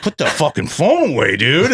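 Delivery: a hammed-up annoyed warning; mock stern rocker. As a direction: imitate the guitarist telling him off. Kerry King scolds the brother.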